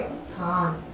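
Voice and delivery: ordinary talk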